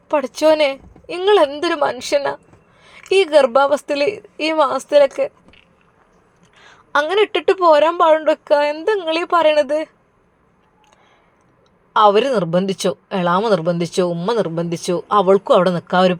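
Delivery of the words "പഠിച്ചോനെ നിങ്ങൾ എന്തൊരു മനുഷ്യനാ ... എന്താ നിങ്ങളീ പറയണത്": a higher-voiced worried mother scolding her husband; the mother chides her husband, pitched up; lightly voiced mother reproaching; upset mother. Mother scolds father over daughter.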